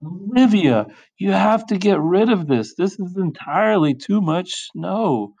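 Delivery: high-pitched parental scolding; exasperated yell. Acted. Parents ordering Olivia to clear the snow, exasperated yell. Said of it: "opened the front door and yelled".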